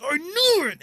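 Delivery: sudden high excited shout as Thor; gleeful shout. Retold or imitated. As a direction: yell it with triumphant glee, loud and high. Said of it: "and then Thor goes".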